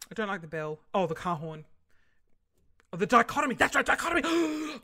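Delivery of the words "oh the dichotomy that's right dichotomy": loud, high burst of delight; excited recall. Real, not acted. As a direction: blurt it out, thrilled to remember. Suddenly remembers her favourite word.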